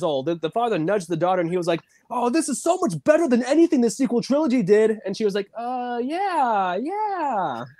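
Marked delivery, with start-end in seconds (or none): 2.1-5.0 high, pushy dad voice; gushing father. Retold or imitated. Father nudging his young daughter to agree, gushing. 5.5-7.6 put-on high, drawn-out child's agreement; daughter going along. Young daughter answering her nudging father, high and obliging.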